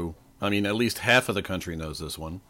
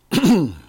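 throat clearing; 8 semitones higher, 8 dB louder than usual. Real, not acted. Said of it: "I'm losing my voice already".

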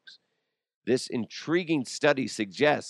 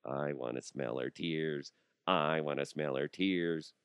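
creepy mock murmur; 7 semitones lower, 7 dB quieter than usual. Coworker at the office, mock creepy hushed craving. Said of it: "you're gonna be like".

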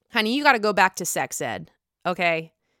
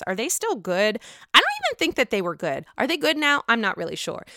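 usual speaking voice

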